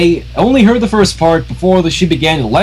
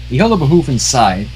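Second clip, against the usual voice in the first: ordinary delivery, nothing marked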